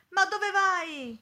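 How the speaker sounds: high, loud, drawn-out call; shouted question. A narrator's reading. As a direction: call slowly after someone leaving. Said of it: "gridò il signore".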